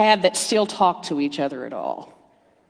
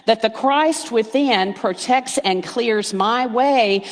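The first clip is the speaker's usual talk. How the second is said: emphatic affirmation; 3 semitones higher, slower than usual. Shown as a demonstration. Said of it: "Did you hear that?".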